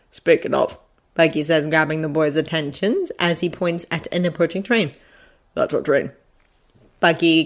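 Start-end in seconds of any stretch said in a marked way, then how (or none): 0.1-0.8 calm adult. A narrator's reading. Adult interrupts the boys. 5.5-6.1 low, soft adult voice; quiet man among excited kids; calm adult. A narrator's reading. Adult points out the train.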